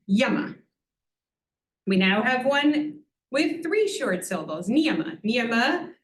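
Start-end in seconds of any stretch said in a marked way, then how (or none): 0.1-0.6 "yama" stressed on the opening "ya"; stress on first syllable. Shown as a demonstration. Teacher demonstrating two short syllables, front-stressed "yama". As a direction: lean on "ya" in "yama", both vowels short. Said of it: "put the emphasis at the beginning".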